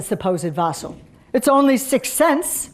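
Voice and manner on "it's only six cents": put-on high, loud Russian-accented peasant; loud Russian accent, pitched up. A narrator's reading. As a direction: offer it heartily in a Russian accent. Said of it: "in a Russian accent".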